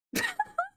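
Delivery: squeaky excited laugh; a squeal of laughter; high squeal laugh. Real, not acted. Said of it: "I got really worked up".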